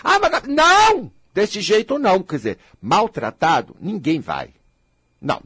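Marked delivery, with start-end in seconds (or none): 0.0-1.1 high, drawn-out defensive "não"; acted knee-jerk yell of refusal; shouted no. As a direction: blurt a loud, stretched refusal, pitched way up. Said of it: "Eu já vou gritando não".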